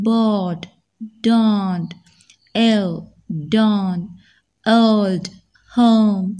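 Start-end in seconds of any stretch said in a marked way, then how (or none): none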